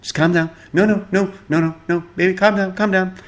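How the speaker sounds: handcuffed husband begging his wife to calm down; pleading husband. Retold or imitated. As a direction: coax her like a nervous husband. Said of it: "her husband's just right there in handcuffs going".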